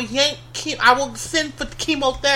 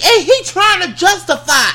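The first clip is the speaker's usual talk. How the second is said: ordinary delivery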